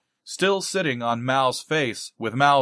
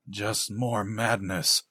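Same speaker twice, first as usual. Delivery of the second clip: softer and slow, as if to himself; inner thought; 4 semitones lower, 6 dB quieter, slower than usual. Acted. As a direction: think it aloud quietly, slowly. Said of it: "Farthing thought".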